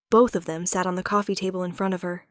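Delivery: plain reading voice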